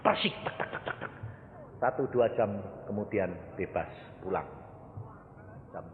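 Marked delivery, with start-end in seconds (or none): 0.0-1.1 unvoiced whispering noise; whispered imitation. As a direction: mimic a secret whisper. (tiruan bisikan)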